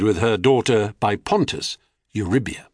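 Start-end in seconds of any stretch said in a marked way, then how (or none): none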